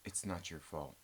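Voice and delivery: low, soft Good Will Hunting style reassurance; low hushed mock-tender comfort; mock therapist comfort.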